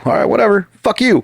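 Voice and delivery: high-pitched, dismissive fuck you; tossing off a fake-casual brush-off; mock dismissive retort. To Dario, years ago, mock dismissive fuck you. Retelling an old argument.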